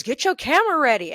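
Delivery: a celebrity snapping at a fan; imitating a snap. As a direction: bark it out fast and impatient. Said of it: "She had snapped at me".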